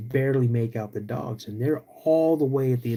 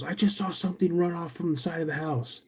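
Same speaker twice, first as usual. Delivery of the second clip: higher, softer urgent voice; buddy telling what he saw outside; lightly voiced shaken buddy; buddy reporting; 6 semitones higher, 4 dB quieter than usual. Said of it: "He goes".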